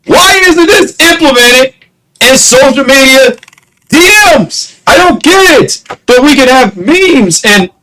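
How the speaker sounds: high-pitched, frustrated outburst; real annoyance boiling over; exasperated rant. Real, not acted. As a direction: rant with the voice pushed way up. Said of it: "it's just so annoying".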